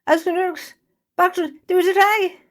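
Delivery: very high, loud nonsense cry; gibberish outburst. Acted. Jay blurting cursed gibberish at friends, shrill nonsense. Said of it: "What was that".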